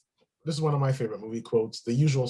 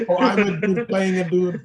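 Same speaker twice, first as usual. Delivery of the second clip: mock character voice; 4 semitones higher, 5 dB louder, slower than usual. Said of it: "another one I like".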